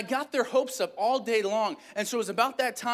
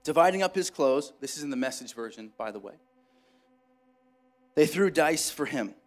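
dramatic scripture reading; 5 semitones lower than usual. Acted. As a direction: tell the passage like a solemn story. Reads Luke passage aloud.

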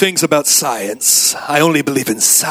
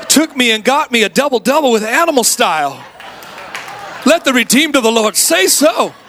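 playful testimony; 7 semitones higher than usual. Acted. Preacher praises the burger he was treated to, building higher.

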